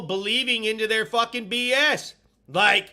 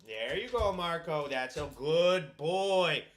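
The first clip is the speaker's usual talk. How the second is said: dog voice; 5 semitones lower, 4 dB quieter than usual. Real, not acted. Talking to his dog.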